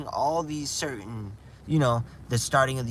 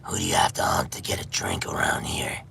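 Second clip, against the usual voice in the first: Gob the Ghoul. Retold or imitated. Imagining a ghoul greeting a human, rasping low ghoul voice. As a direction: growl it out like a dried-up ghoul. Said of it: "we could all sound like Gob the Ghoul".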